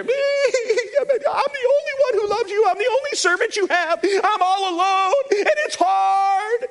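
high, crying whine of a self-pitying prophet; high tearful whine, sorry for himself; whiny Elijah. Retold or imitated. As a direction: play Elijah sobbing that he's all alone. Whiny Elijah complaining to God.

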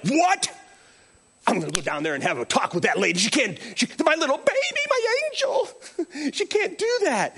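mock-indignant dad, fast and heated; hammed-up angry father defending his baby; protective dad outburst. Dad ready to confront the teacher, mock outrage. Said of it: "normally I would have been like".

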